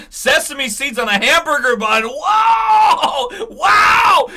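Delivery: high shrieking fake awe; mock amazement. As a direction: scream it in sarcastic wonder. Mocking the sesame bun reveal.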